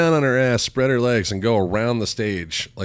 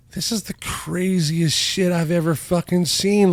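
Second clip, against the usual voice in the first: high, slow, stunned disbelief; reliving the shock, drawn out and high; higher and slower, amazed; 9 semitones higher than usual. Retold or imitated. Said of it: "And I'm like".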